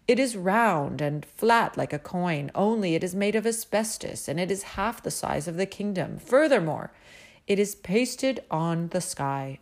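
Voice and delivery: a prim, self-satisfied court mathematician, pleased with himself; royal mathematician. A narrator's reading. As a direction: show off the list proudly. Mathematician explains the moon.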